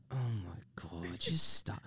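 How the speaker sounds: fed-up muttering nobody should hear; exasperated whisper in the elevator. Retold or imitated. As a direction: whisper it under your breath. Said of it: "And I'm like".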